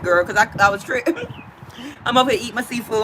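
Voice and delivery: ordinary delivery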